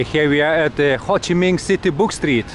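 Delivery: ordinary delivery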